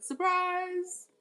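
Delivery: high sing-song surprise; taunting party-reveal surprise; gleeful exclaim. Real, not acted. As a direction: tease them with a bright reveal. Repeated taunt to the haters.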